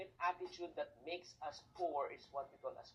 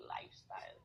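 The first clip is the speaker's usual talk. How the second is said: whisper; 4 dB quieter than usual. Shown as a demonstration. Whispered punchline.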